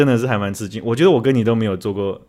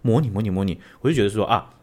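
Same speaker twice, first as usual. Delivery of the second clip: normal talking voice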